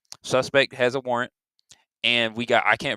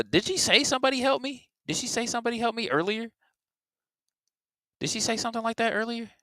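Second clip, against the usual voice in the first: surprised, much higher question; 11 semitones higher, 3 dB quieter than usual. Real, not acted. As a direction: ask it in sudden disbelief. Surprised at clip.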